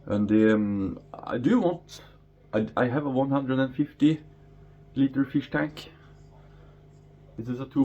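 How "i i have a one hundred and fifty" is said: normal talking voice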